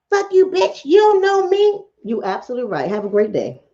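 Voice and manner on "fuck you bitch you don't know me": high, hostile mock snap from a stranger; furious stranger, hammed-up high; angry woman. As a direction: play the rude stranger, pitched way up. Quoting angry woman's reply.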